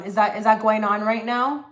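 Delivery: usual speaking voice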